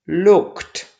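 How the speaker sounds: "looked" ending in a crisp T; final T sound. Shown as a demonstration. Teacher showing learners that ED after K is T, final T sound. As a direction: say "looked" as one syllable with a T ending. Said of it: "we pronounce T".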